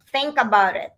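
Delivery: usual speaking voice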